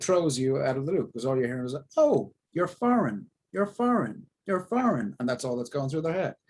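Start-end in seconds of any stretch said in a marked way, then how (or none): 2.0-5.1 high, swooping, repetitive mock astonishment; mock realization. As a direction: hammer it like a stuck, exaggerated thought. Acts casting director's fixated inner thought.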